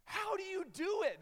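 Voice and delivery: high, loud incredulous outburst; astonished. Retold or imitated. Young traveler questioning the daring missionary, acted loud astonishment. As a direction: play up the bewildered disbelief. Acting his astonishment at her stories.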